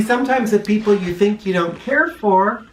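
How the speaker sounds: ordinary delivery, nothing marked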